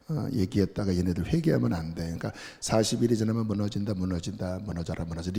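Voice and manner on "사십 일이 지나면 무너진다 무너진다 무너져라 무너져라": mumbled mock proclamation. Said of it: "이런 식으로".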